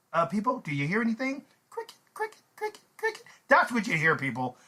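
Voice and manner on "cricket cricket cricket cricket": tiny, high, soft cricket chirps; little squeaky chirping voice; cricket sounds. Retold or imitated. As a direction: imitate crickets in an awkward silence. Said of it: "Nothing but cricket sounds".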